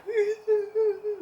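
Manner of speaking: high, loud wailing sob; exaggerated ugly-cry wail; mock bawling. Showing how hard the tears came, high wailing mock sob. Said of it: "like, I'm literally bawling my eyes out".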